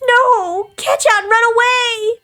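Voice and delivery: high, desperate shriek; screamed warning. Acted. Captive warns the boy to flee, screamed warning. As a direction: scream for him to get away.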